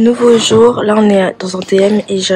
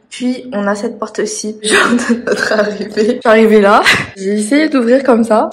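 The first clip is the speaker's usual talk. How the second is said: ordinary talk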